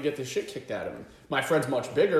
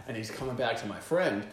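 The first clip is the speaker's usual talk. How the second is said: normal talking voice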